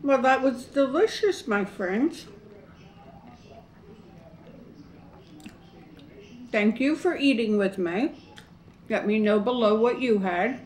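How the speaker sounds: talking through a mouthful of food; words round a bite, still chewing; mouth full. Real, not acted. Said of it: "I hate talking with my mouth full".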